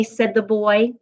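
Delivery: ordinary delivery, nothing marked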